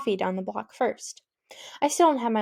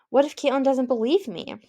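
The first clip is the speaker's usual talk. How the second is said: ordinary delivery, nothing marked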